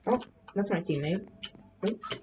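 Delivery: ordinary talk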